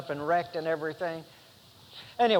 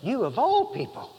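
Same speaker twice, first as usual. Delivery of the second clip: "You" lifted higher for emphasis; stress on You; 6 semitones higher than usual. Shown as a demonstration. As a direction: lean on "You of all people". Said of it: "in an emphatic position".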